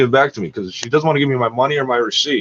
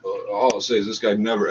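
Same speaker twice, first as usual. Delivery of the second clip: normal talking voice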